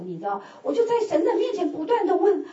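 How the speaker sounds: normal talking voice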